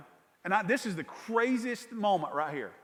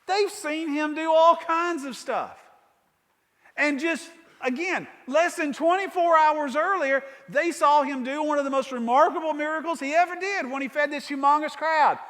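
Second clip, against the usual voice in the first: higher and louder, slowing at first; fervent preaching; 7 semitones higher, 6 dB louder than usual. Real, not acted. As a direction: build the miracle up with force. Preaching builds on miracle.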